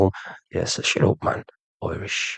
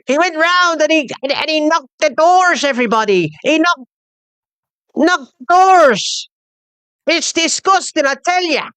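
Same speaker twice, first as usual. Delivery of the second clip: high, slow squeaky Irish mimicry; slow, much higher taunting Irish voice; mock Irish accent; 13 semitones higher than usual. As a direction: parody the rant in a put-on Irish brogue. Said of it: "I keep mocking the Irish".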